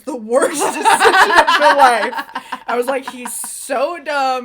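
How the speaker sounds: loud, slow laughing scolding; exaggerated laughing disapproval; laughing mock warning. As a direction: laugh through a mock scolding of your friend. Warning friend about dating ex.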